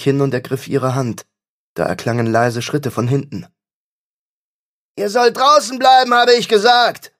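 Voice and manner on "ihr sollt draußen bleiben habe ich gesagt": furious roar, much higher and louder. Acted. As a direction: bellow it so it echoes like thunder. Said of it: "brüllte er".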